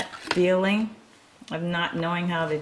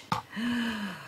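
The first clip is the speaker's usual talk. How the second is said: soft, high sharp intake of breath; surprised gasp; 7 semitones higher, 5 dB quieter than usual. Real, not acted. Reader turning the page, soft surprised gasp.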